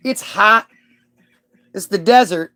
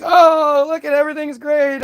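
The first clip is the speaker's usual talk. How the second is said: mock delight; 9 semitones higher than usual. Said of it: "They're just like".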